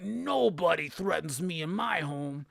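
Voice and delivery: grandly threatening crime lord in his own house; menacing mob boss. Acted. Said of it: "And he's like".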